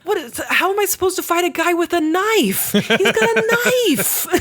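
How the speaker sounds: high, laughing mock panic; hammed-up frightened wail; panicked protest. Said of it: "he's like".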